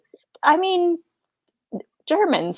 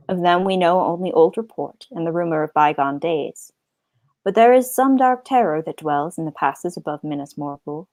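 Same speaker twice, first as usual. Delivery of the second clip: low, steady story reading; quiet narration voice; reading aloud; 5 semitones lower, 3 dB quieter than usual. Acted. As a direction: read it like a solemn tale. Reads the book aloud.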